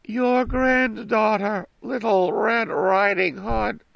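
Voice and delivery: put-on very high, slow girlish voice; wolf putting on a little girl's voice; wolf faking a girl's voice. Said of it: "imitating her voice".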